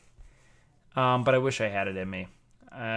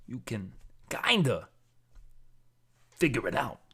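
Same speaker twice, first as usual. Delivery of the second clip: soft halting Walken voice; Christopher Walken impression; 2 semitones lower, 5 dB quieter than usual. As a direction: pause oddly like Christopher Walken. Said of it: "Can I do Christopher Walken?".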